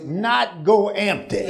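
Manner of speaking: slow shouted "not go empty", "go" hit hard; preacher hammering "not go empty"; stress on not go empty. Acted. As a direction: drag out "not go empty" and punch "go". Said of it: "strongest word in the English dialect".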